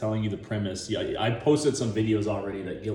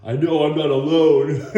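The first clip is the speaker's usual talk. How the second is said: loud, higher hammed-up Rocky line; mock-heroic Rocky, belting it out; 4 semitones higher, 8 dB louder than usual. Rocky vowing at Apollo's bedside, hammed up. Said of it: "He's like".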